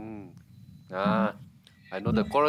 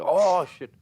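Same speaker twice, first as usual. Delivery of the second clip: sudden jump up, louder, alarmed; mock alarm; 7 semitones higher, 6 dB louder than usual. On realising they used those lines, a startled oh shit. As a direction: blurt it out in shock. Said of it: "I was like".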